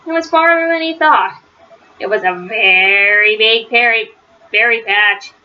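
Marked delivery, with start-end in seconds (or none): none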